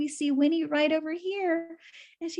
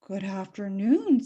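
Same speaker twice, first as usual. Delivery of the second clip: silky voice; 5 semitones lower than usual. Acted. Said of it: "said a silky voice".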